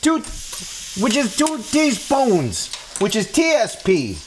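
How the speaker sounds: high, bouncy counting; high playful voice. Acted. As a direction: count like a cheerful kids' host.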